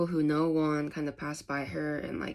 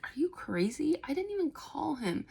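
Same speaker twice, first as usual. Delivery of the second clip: indignant; 10 semitones higher than usual. Retold or imitated. Won indignant.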